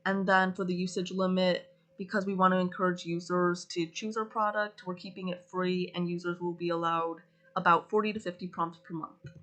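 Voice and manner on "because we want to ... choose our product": normal talking voice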